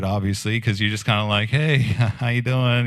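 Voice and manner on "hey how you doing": awkward greeting with a small laugh. Retold or imitated. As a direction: imitate an awkward hello, chuckling mid-greeting. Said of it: "it was a little awkward".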